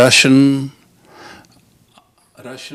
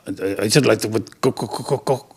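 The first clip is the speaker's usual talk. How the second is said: stuck stutter on co- over and over; can't get the word out, stutter it; stammering; 2 semitones lower, 6 dB quieter, faster than usual. Acted. Embarrassed customer asking the teller for condoms, stuttering.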